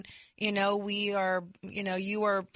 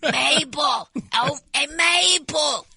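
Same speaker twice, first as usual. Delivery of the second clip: put-on higher, louder, slow old woman calling out; old lady; 6 semitones higher, 6 dB louder, slower than usual. Wife playing an old woman calling her friend Mabel, high and excited. Mocking old ladies spotting him.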